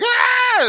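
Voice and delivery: high-pitched mock scream; imitated scream. Imitating a frightened girl on the metro, mock scream. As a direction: imitate a girl's shriek, not at full volume. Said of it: "this girl starts screaming".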